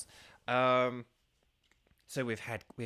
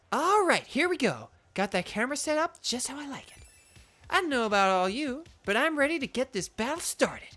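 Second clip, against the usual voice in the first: high-pitched, showy character voice; Iono; 11 semitones higher, 5 dB louder than usual. Acted. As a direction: play her like a theatrical show host. Voicing Iono's game lines.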